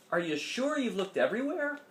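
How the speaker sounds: a hint of a worried mother; lightly voiced mom. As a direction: ask it like a concerned mom.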